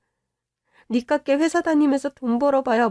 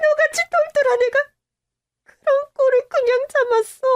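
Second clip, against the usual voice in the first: much higher, anguished rebuke; distraught outrage; 10 semitones higher than usual. Acted. Mother scolds her daughter for enduring it, anguished. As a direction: cry it out, heartbroken and furious.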